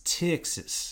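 Texas drawled out as "Tix-sis"; slow Texan twang on Texas; Texas accent. Shown as a demonstration. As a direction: stretch TEXAS with a short i, Texan style. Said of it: "if you wanna do a Texas accent".

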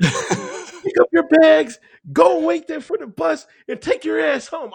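high-pitched, hammed-up barked orders; mock drill sergeant. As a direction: imitate a sergeant throwing a recruit out. Retold drill sergeant ordering him out.

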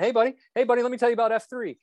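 high, fast call after a runner. Retold or imitated. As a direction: holler to a stranger ahead while sprinting. Olaf chasing a runner.

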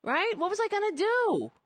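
high, whining mock excuse; whiny mock voice. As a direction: whine it up high like a pathetic excuse. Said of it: "that's what it sounds like to me".

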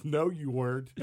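mock rebuke with a grin; lightly voiced amused general. General calls the lie.

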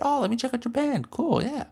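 very high, overly sweet voice; high mock-cheerful voice; fake-friendly customer. Mock-friendly self to barista.

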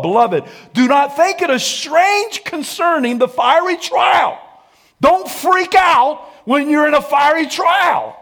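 high, loud preacher's shout; full-force pulpit shout; fiery preaching. Acted. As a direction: preach it hard, rising to a cry.

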